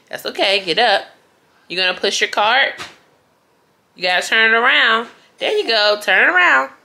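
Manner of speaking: put-on encouraging singsong to a baby; talking to a toddler. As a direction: cheer the little one on. Coaching the toddler with the cart.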